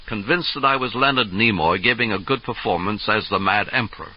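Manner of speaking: usual speaking voice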